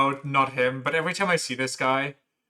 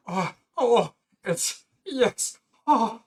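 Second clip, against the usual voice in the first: startled; 5 semitones higher, much slower than usual. Acted. Character startled at a visitor.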